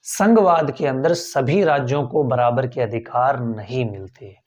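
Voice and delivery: ordinary delivery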